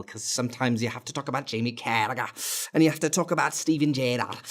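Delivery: fast mock Scouse, lightly done; Scouse accent. Fan talking about Liverpool legends in a mock Scouse accent. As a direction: slip into a hint of Liverpool Scouse. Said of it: "a bit of a Scouse accent as well".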